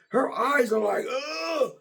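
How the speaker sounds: crying yelp rising high at the end; terrified gasp. Retold or imitated. Coworker hears an imitated terrified cry.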